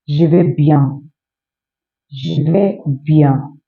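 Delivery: je vais bien drawn out slowly. Shown as a demonstration. Teacher slowly modelling the reply je vais bien. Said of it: "Then you respond".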